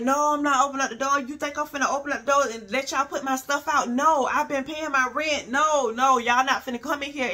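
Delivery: mock-yell her refusal through the door, rushing; retold refusal. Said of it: "So I'm like".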